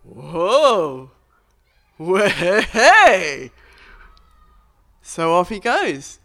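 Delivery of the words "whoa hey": loud joyful whoop; excited whoop. Acted. Healed man jumping up, loud and joyful. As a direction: cheer like you just got your legs back.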